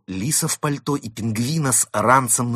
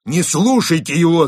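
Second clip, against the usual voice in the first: high, loud urgent denial; shout; 8 semitones higher, 9 dB louder than usual. A narrator's reading. Second wolf pleading with police, shouting. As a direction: cry out to stop them believing him. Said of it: "закричал второй волк полицейским".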